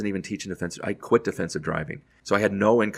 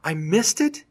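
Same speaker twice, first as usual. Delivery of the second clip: shocked disbelief; 10 semitones higher, much slower than usual. Retold or imitated. Learns he missed the Emmys.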